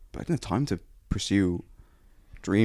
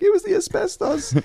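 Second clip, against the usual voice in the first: put-on character voice. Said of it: "he's like".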